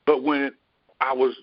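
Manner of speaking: ordinary delivery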